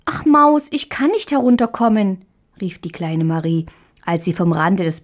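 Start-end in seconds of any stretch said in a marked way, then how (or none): 0.1-2.2 put-on higher, plaintive small child; a girl stuck at the bed's edge; fretful little girl. A narrator's reading. Marie calls down to the mouse, higher plaintive girl.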